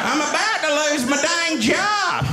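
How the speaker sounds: high, hammed-up South Carolina accent; put-on Southern accent. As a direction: wail it out as a baffled Southerner. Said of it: "never been to South Carolina before".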